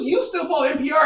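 ordinary talk

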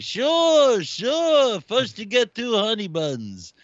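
high, slow put-on deli guy voice; Aki impression. Guest imitates the deli guy Aki taking a breakfast order. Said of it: "He's like".

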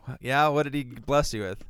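usual speaking voice

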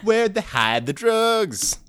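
slow, drawn-out laughing repeat; slow laughing echo. Pretend. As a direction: stretch each word while laughing. Echoed slowly, laughing.

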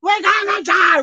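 high, slow mock scream of terror; mock panicked scream. Preacher acting scared disciples, mock panicked scream. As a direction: wail it like a man sure he'll drown. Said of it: "We're screaming to the top of our lungs".

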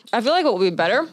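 ordinary delivery

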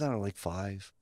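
low, soft shrug; understated, noncommittal mumble; retold offhand answer. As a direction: toss it off quietly, imitating a vague boss.

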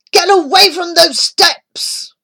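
loud, high furious yell across a yard; angry shout. A narrator's reading. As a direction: bellow at the trespassing boys. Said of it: "Granger's voice echoed across the yard".

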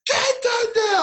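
even harder hammed-up screaming coach; coach screaming again. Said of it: "screaming at me".

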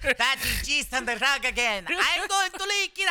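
lightly voiced high dog. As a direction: voice the little dog, rising. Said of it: "I'm gonna let [name] do his voice".

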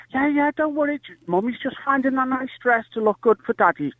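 high, lightly mocked mum voice; placating mother. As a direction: imitate a mum putting her child off. Mother reassuring daughter while shopping.

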